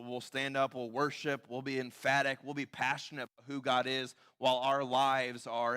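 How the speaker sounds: normal talking voice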